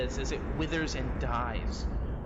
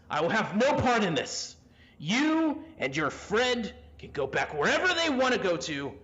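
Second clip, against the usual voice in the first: defiant father; 2 semitones higher than usual. Acted. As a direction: refuse your son with forceful, heaving indignation. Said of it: "you hear your father responding back".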